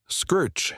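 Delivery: high sharp scraping sound effect; knife scraping wood. A narrator's reading. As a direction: rasp it out like a blade gouging bark. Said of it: "The sound of a knife carving wood".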